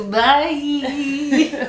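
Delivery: high, laughing dismissal; quick laughing goodbye; curt bye. Retold or imitated. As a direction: brush him off with a laugh. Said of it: "I was like".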